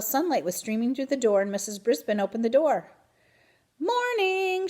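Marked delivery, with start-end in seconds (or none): 3.8-4.7 high, cheery good morning. A narrator's reading. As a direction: greet the class brightly. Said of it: "she said in a cheery voice".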